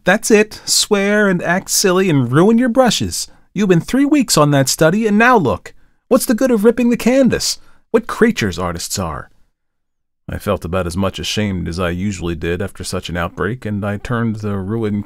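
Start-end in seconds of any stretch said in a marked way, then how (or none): 0.0-9.3 high, loud scolding tirade; scolding outburst. A narrator's reading. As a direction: tear into him like an exasperated model.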